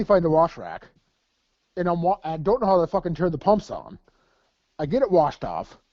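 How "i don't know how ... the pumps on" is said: ordinary talk, nothing marked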